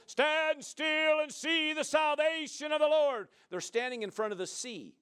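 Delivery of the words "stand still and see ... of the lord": loud, slow prophet's proclamation; hammed-up movie command to the crowd; mock-epic Moses shout; heroic Moses. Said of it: "he turns, you know, to the people".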